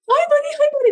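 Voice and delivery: loud greeting. Shown as a demonstration. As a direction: burst in with a big loud hello. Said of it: "you being loud might not create delight for them".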